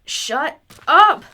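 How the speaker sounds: sharp playful shout; bursting shout of protest; loud outburst. Acted. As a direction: shout it down the friend.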